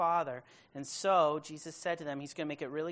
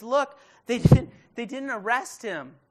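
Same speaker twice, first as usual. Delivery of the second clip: emphatic preaching; 7 semitones higher, 15 dB louder than usual. Acted. Preacher stressing John's point.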